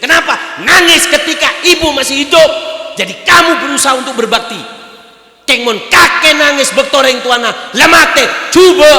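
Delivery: high, loud preaching shout; shouting sermon. Real, not acted. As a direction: preach it at full force. Preaching at full force. (berkhotbah berteriak)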